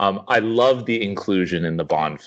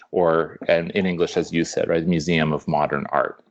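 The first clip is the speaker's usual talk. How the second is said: normal talking voice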